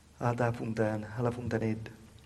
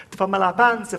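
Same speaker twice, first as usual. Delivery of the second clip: much louder, higher mocking taunt; loud jeer; 4 semitones higher, 13 dB louder, faster than usual. [name] shouting a taunt at his sister.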